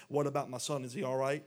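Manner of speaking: loud, insistent question; demanding. Retold or imitated. King David interrupts to ask about his son, demanding. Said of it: "the king demanded".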